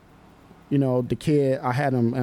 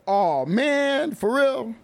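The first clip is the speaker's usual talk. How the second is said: imitating whiny kid; 11 semitones higher, much slower than usual. Kid complaining about the rule.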